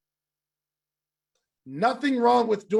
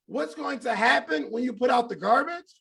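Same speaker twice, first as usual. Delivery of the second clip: usual speaking voice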